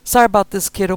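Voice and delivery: put-on old man with shotgun warning the kid, loud; armed old man threatening. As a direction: bark it through the door. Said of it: "Marty said".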